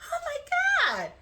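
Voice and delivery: high, drawn-out excited cry; excited reaction. Retold or imitated. Woman retelling the moment she saw the ring, excited. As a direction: squeal it slowly in delighted shock. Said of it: "I'm excited".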